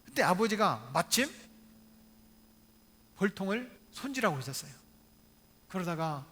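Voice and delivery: ordinary delivery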